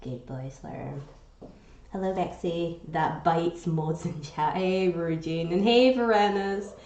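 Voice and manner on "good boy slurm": fond praise. Real, not acted. Praising her pet.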